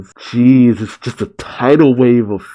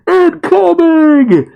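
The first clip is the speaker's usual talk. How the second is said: very high, drawn-out mock warning yell; Gang Orca crying out; 4 dB louder, much slower than usual. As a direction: stretch out an exaggerated high-pitched cry. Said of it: "as Gang Orca cries out".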